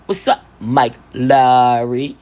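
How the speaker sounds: slow, laid-back kid imitating a movie cop; little boy's cool greeting. Mother imitating her toddler greeting a friend, slow and cool. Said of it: "he said it just like that".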